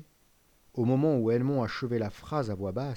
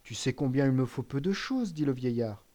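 plain reading voice

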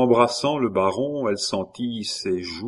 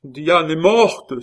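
loud, high, drawn-out cry of despair; old man's anguished outcry; 9 semitones higher, 8 dB louder, slower than usual. A narrator's reading. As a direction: cry out the news slowly in despair. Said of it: "s'écria le vieillard ... geste désespéré".

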